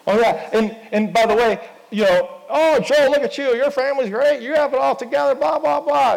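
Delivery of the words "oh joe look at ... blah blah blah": high-pitched, gushing imitation of praise; mock gushing admirer. Mimicking gushing praise of someone's life.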